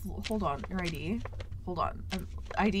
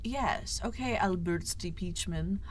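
ordinary talk

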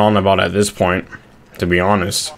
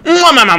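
kiss noises; 14 semitones higher, 4 dB louder than usual. Retold or imitated. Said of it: "imitating kissing".

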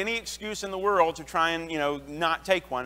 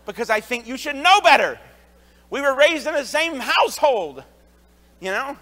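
louder, higher exasperation; exasperated; 6 semitones higher, 8 dB louder than usual. Real, not acted. Preacher vents about his brother and sister, exasperated and louder. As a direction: let the frustration with siblings burst out.

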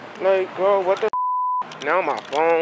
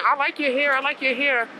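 lightly mocking the boys, pitched high; boys' compliment; 8 semitones higher than usual. Vlogger quoting boys who compliment the hair, lightly mocking. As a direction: imitate their compliment in a higher voice. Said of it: "All the boys keep saying".

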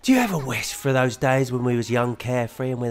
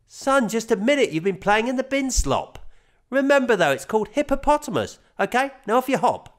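lightly raised, corrective dad voice; patient father; 10 semitones higher, 3 dB louder than usual. A narrator's reading. Father correcting son's word, light patient dad voice. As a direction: correct him gently like a dad.

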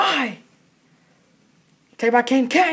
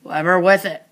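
rapid live hockey play-by-play; frantic mock sports commentary. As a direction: call the game as it happens.

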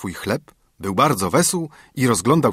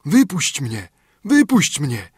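higher, insistent calling; urgent plea; 5 semitones higher, 3 dB louder, slower than usual. Acted. Creature in the flask begs for release, urgent. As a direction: call out to be let go, pitch jumping up then falling.

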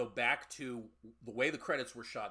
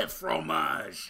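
loud, laughing cheese cry; answering a mock French cry with a louder one; mock French shout; 5 semitones higher, 10 dB louder than usual. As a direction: yell it like a cartoon Frenchman. Mocking the French film.

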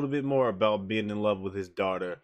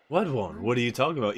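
normal talking voice

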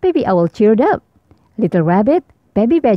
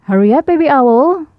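put-on raised, higher call up to Baby Owl; 6 semitones higher, 5 dB louder than usual. A narrator's reading. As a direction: call him from downstairs, louder. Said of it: "called Mom".

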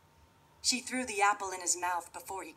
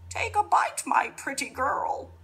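witch voice; 8 semitones higher, 10 dB louder than usual. Acted. Witch offers poisoned apple.

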